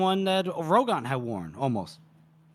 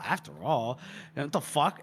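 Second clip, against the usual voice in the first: muted incredulity; 2 semitones lower, 6 dB quieter than usual. Retold or imitated. Host echoing the duke's congratulation, muted incredulity. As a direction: echo it back under your breath, doubting. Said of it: "she's incredulous".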